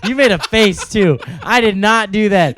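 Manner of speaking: loud, high laughing protest; indignant denial. Real, not acted. Accused of doing an accent, loud indignant denial. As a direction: protest loudly, half laughing.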